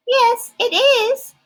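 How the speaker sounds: very high, smooth and polite monster; high silky Ralph. Acted. Ralph offers his claws for inspection, very high and silky. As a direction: keep it high and silky, very proper. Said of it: "high, silky voice".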